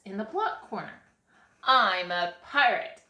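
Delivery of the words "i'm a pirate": loud, proud boast; bragging. A narrator's reading. Boy in the block corner, bragging. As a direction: announce it boastfully. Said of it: "Alex bragged".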